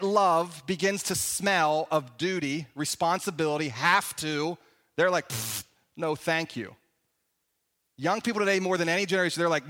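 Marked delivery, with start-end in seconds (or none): 5.3-6.7 low, soft scoffing brush-off; dismissive scoff. Retold or imitated. Young people brushing off duty with a low scoff. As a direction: scoff and wave it away.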